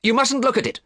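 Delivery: urgent. A narrator's reading. Dorian blocks the painting.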